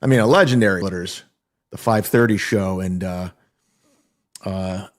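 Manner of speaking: ordinary delivery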